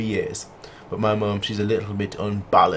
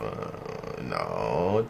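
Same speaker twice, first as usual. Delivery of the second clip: dreading whisper; 13 semitones lower, 7 dB quieter than usual. Real, not acted.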